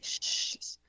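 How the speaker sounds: soft, drawn-out shush; hushing sound, mimicking mom; imitated shushing. Mom hushing the room during her contractions, soft imitated shush. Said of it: "she'd be like".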